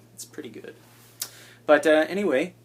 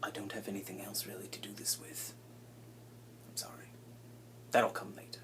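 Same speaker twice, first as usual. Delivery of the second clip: whispered aside; 3 semitones lower, 11 dB quieter than usual. Real, not acted. YouTuber whispering a sheepish apology to viewers. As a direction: drop to a whisper for the confession.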